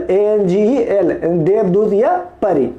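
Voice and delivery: ordinary talk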